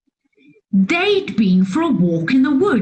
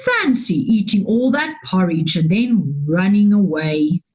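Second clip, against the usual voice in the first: lower, lightly growled bear voice; gruff Daddy Bear; 8 semitones lower than usual. A narrator's reading. Daddy Bear muses on strange people, low growl. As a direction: grumble like a puzzled bear. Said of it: "growled Daddy Bear".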